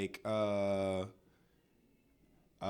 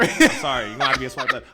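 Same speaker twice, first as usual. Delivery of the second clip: laughing; 12 dB louder than usual. Pretend. Laughing mock apology.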